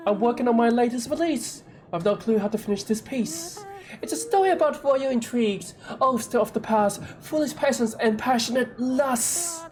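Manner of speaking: a hint of a dramatic bard; lightly voiced vain poet. Poet pitches his work.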